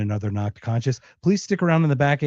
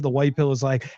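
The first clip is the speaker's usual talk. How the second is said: ordinary delivery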